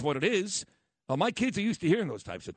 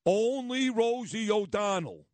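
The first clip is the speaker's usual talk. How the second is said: slow, drawn-out quote, lifted higher; quoted punchline; 5 semitones higher, slower than usual. Retold or imitated. Radio host quotes a politician's brilliant debate answer, slow punchline delivery. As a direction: stretch it out higher, like a famous comeback. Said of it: "and I quote".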